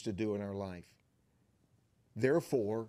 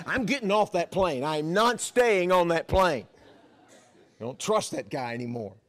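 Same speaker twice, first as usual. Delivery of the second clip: mock alarm; 5 semitones higher, 7 dB louder than usual. Pastor imagines fleeing the plane.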